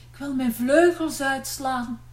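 ordinary delivery

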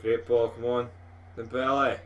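ordinary talk

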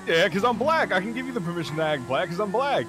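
loud excited. Real, not acted.

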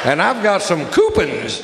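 eager shouted boast; excited shout. Retold or imitated. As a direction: yell it like a proud bargain hunter. Eager guy outside the park.

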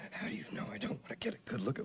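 flirty whisper. Acted. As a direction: whisper it with a sly tease.

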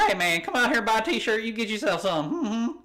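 high, loud Southern huckster; mock Southern accent. Playing a t-shirt seller, loud Southern accent. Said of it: "if you sound like that".

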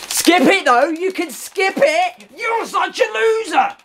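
high, slow sing-song jeer at the opponent; mocking taunt. Real, not acted. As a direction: taunt him like a playground bully. Taunting opponent who skips replays.

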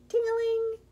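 high ringing ting-a-ling; sing-song, high-pitched bell; bell imitation. A narrator's reading. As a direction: chime it like a bike bell.

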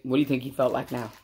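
plain reading voice

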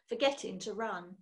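ordinary delivery

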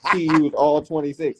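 normal talking voice

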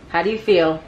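usual speaking voice

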